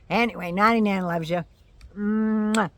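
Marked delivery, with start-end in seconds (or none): 2.0-2.7 a smacking air kiss; between kisses. Real, not acted. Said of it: "blowing kisses to viewers".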